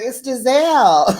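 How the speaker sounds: loud laughing call-out; shouted with a laugh. Retold or imitated. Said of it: "she's like".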